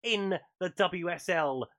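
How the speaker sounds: radio sports roundup read; news read. Acted. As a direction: deliver the results like a bulletin. Reads the weekly football roundup.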